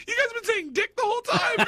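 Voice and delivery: very high squeak through laughter; laughing protest. Retold or imitated. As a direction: imitate her complaint while breaking up. Retold guest protest while laughing.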